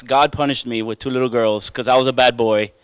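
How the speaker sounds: normal talking voice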